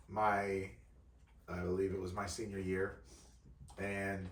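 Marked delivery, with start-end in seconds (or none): none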